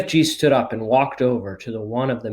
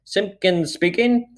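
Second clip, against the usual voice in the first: higher, slow self-important phone voice; important telephone voice; 8 semitones higher, slower than usual. A narrator's reading. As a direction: answer the phone pompously. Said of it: "very important telephony voice".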